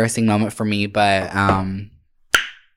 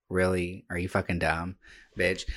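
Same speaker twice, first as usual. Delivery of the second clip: much softer, low scolding mutter; self-scolding mutter; 4 semitones lower, 11 dB quieter than usual. Retold or imitated. Driver scolds herself after the wreck, much softer and lower. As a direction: mutter it at yourself in disgust. Retold inner thought at crash.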